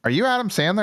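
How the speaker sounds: high, incredulous question; imitating surprised stranger. Voices starstruck stranger asking.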